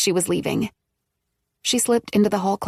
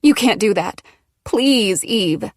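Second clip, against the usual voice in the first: begging; 3 dB louder, slower than usual. A narrator's reading. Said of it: "Maggie begged".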